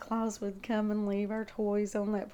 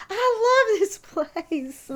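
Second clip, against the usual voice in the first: high, slow, voice breaking with emotion; tearful joy; 12 semitones higher, 11 dB louder, much slower than usual. Retold or imitated. Back on her childhood ground after years away, high, slow and tearful. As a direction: let the love for the place choke you up. Retelling kissing home ground.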